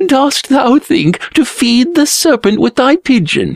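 high-pitched mocking jeer; sneering. A narrator's reading. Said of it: "he sneered".

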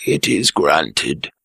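slow, low royal assent; king granting. Acted.

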